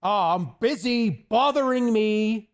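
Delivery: a playfully rude dismissal; mock rude brush-off. Joking rude reply to a fan, ending loud and slow. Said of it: "I'm just like".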